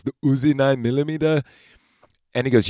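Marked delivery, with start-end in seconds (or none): 0.0-1.4 put-on Austrian-accented "The Uzi nine millimeter", flat and heavy; Arnold's pronunciation. Retelling Terminator's best line in an Austrian accent. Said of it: "just the way he pronounces it".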